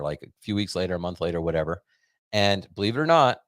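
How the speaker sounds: normal talking voice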